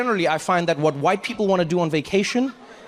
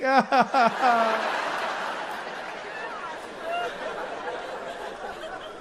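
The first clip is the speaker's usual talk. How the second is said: granny cackle; 6 semitones higher, 6 dB quieter than usual. Retold or imitated. Grandma laughing.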